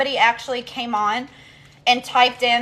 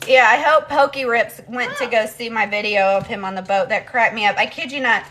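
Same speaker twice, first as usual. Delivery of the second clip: usual speaking voice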